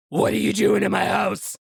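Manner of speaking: shouty, failed stab at Scottish; attempted Scottish accent. Retelling how the homeowner catches an intruder, attempted Scottish accent. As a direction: bellow it in a badly faked Scottish accent. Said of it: "I can't do a Scottish accent".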